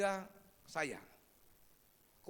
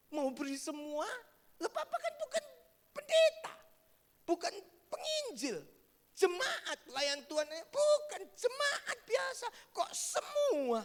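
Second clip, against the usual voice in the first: high, near-crying plea, louder; distressed family member; 6 dB louder than usual. Retold or imitated. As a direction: protest through rising distress. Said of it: "Keluarganya ada yang ngomong".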